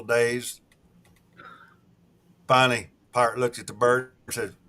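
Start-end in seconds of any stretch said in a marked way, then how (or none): none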